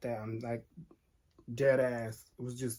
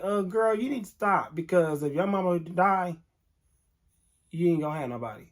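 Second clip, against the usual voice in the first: loud, higher, no-nonsense telling-off; stern auntie calling a kid out; sharp scolding; 4 semitones higher, 7 dB louder than usual. Retold or imitated. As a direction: scold the girl loud and firm. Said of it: "I did say it just like that".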